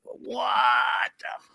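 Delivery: high, drawn-out, softened "what"; retold disbelief. Teller reacting to his friend suddenly normal, stunned. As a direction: trail off in stunned mock disbelief.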